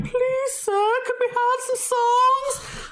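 squeaky mock plea, cap in hand; mock timid begging. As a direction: play a meek band begging for songs. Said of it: "and they're like".

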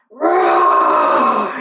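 deep, loud gorilla roar. A narrator's reading. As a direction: roar like a silverback to scare the leopard. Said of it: "he roared".

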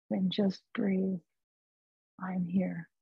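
slow and soft, barely above a murmur; calm soothing. Retold or imitated. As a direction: soothe someone frightened. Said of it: "this voice was very calm".

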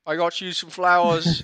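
put-on high, loud Irish accent. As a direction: put on a broad Irish accent for the lovestruck kid. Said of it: "That was my Irish accent".

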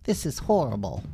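high goofy wail; dismayed Dinky. Acted. Dinky lamenting Lila's fate, goofy high dismay. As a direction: mourn in a silly way.

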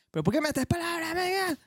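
a light high-pitched mock of a fan; mocking a complainer. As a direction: squeak it like a nagging fan. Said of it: "me dice siempre".

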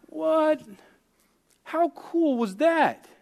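high, slow, amazed what; a touch of wonder, retold. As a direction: marvel at it, slowly.

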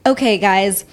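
ordinary talk

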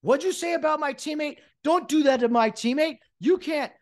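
high-pitched indignant teammate; mock outrage. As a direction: imitate a player starting World War Three. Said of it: "it's like World War Three".

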